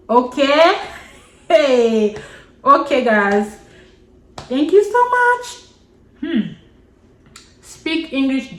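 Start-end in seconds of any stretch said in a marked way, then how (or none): 0.1-6.5 laughing sign-off. Real, not acted. Laughing wrap-up to viewers.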